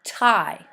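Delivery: tie said slowly and clearly for spelling; dictated syllable. Shown as a demonstration. Teacher dictating tie to students, carefully. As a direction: sound out tie for the class. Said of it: "The first one".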